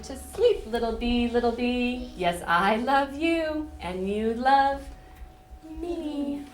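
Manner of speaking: gentle sing-song bedtime read; reading to children. Acted. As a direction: lull them toward sleep. Reads bedtime book to children.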